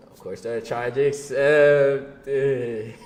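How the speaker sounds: every word stretched out slowly; slow dramatic drawl. Acted. Laughing at how the calls kept getting accepted, slow drawn-out delivery. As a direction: drag it out like a punchline. Punchline repeated after laughing.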